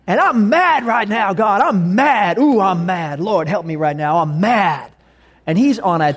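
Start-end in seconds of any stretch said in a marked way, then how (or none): 0.1-4.9 loud, slow, drawn-out MAD; angry yelling at God. Acted. Man on a tangent telling God he's mad, angry loud rant. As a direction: rant at God, settling down in pitch but staying loud. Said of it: "he's yelling out to God".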